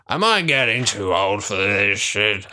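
put-on slow, weary old man. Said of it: "I'm gonna do this in an old man voice now".

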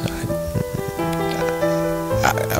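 usual speaking voice

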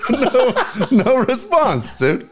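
high-pitched helpless laugh; can't stop laughing, words squeezed out; high laughter. Real, not acted.

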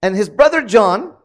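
normal talking voice

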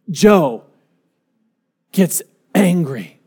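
ordinary delivery

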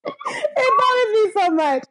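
high, helpless laughing complaint; cry-laugh through the words; giggling real exasperation; laughing hard. Real, not acted.

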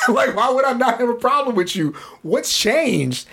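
talking through a laugh. Real, not acted. Telling his friend how he answered Larry, laughing. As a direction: let the laugh carry the question. Laughing while retelling reply.

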